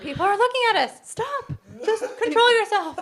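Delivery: high, loud, hurried telling-off; hammed-up embarrassed plea, half laughing; mock urgent scolding. Replaying own plea to embarrassed partner.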